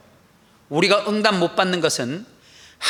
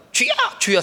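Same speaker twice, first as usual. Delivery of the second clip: calling out; 12 semitones higher, 3 dB louder than usual. Shown as a demonstration. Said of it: "세 번을 불러야 합니다".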